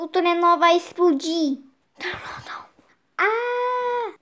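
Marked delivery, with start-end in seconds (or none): 2.0-2.7 whispered, much softer denial; scared whisper. Pretend. Toy figure fears the sled will explode, whispered dread. 3.2-4.1 pretend scream. Toys falling apart.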